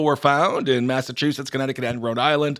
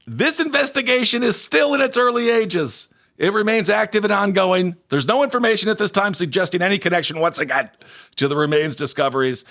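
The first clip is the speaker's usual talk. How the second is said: higher, mock official read; official statement; 6 semitones higher than usual. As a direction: read it like a stiff press release. Reading police statement.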